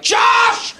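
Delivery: hammed-up yell of a name; mock scolding yell. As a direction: play an outraged papa bear calling his buddy. Parodies a male mama bear.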